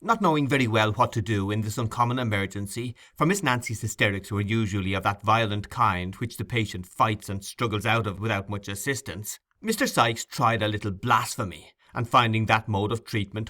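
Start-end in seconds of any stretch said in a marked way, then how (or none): none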